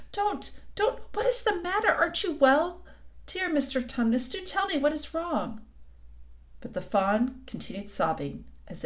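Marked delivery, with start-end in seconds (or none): 0.1-5.6 high, worried pleading; great distress. A narrator's reading. Lucy begs the sobbing faun to tell her, distressed. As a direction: implore him gently in a high voice. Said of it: "said Lucy in great distress".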